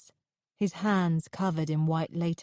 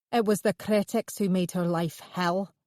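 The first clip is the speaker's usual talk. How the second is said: ordinary delivery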